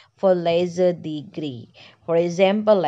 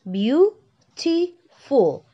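Beautiful stretched out slowly, higher, each syllable drawn apart; teacher drawing out Beautiful syllable by syllable; 5 semitones higher, much slower than usual. Shown as a demonstration. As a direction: spell out Beautiful slowly for the class to count syllables. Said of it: "Three syllable".

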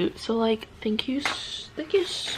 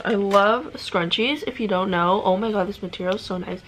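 usual speaking voice

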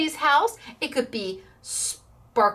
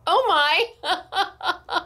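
laughing; 6 semitones higher than usual. Retold or imitated. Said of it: "in the nicest way possible".

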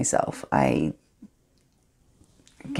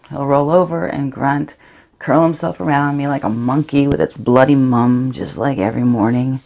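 bitter; 8 dB louder than usual. Acted. Bitter about husband.

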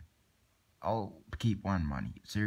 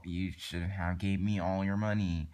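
ordinary talk, nothing marked

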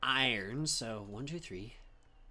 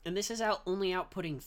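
exasperated; 9 semitones higher, 4 dB louder than usual. Real, not acted. Frustrated at losing power.

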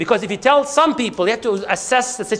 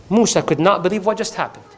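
usual speaking voice

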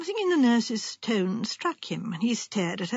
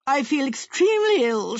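slightly raised, wounded complaint; affronted invalid; 3 semitones higher, 4 dB louder than usual. Acted. Wife announcing illness to end the talk, affronted. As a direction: complain like a put-upon invalid.

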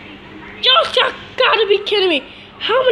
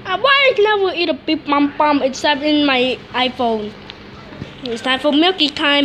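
usual speaking voice

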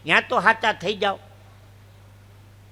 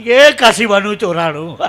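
loud belted call; shouted punchline; 9 dB louder than usual. Acted. Comic repeats the misheard phone number, shouting.

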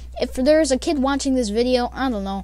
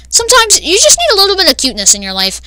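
higher, louder, fast, tinny like a bad mic; bad mic impression; 7 semitones higher, 9 dB louder, faster than usual. Narrator mimicking the cat girl's catchphrase through her awful mic: tinny bad-mic voice. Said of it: "it would sound like this".

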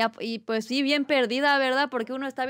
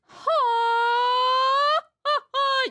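high, loud ringing mariachi yell; mariachi grito; 12 semitones higher, 3 dB louder, much slower than usual. Shown as a demonstration. Streamer shows chat how she yells mariachi style, a high loud grito. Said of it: "así grito marachi".

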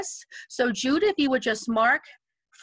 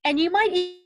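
usual speaking voice

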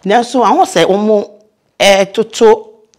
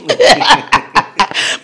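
a short bright burst of laughter, higher; real laugh; 7 semitones higher than usual. Real, not acted. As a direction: laugh out at the funny memory. Laughs at retold airport mix-up.

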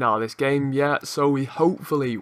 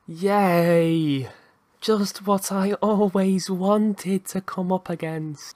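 slow, higher mock delight; sarcastic cheer; 4 semitones higher, much slower than usual. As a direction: feign delight at a dreaded opponent. Dreaded opponent team appears.

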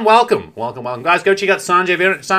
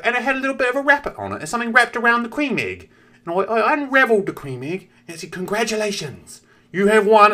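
put-on high and fast kid voice; kid voice again; 7 semitones higher than usual. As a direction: rattle it off like an excited child. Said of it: "And then he's like".

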